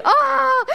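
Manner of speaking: high, loud frazzled cry; exasperated scream. Retold or imitated. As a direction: let out a loud overwhelmed yelp. Chaos in the food court.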